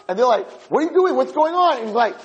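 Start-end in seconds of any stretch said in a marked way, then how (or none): 0.7-1.8 high, startled acted questions; alarmed bafflement. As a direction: act out guards caught off guard. Guards startled as he bursts in.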